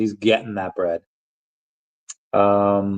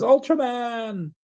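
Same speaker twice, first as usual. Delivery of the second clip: much higher, stretched-out superhero cry; high drawn-out call; much slower than usual. Acted. Streamer reading out a chat message, high drawn-out call. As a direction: hold the name long and high. Reading a viewer's shouted name.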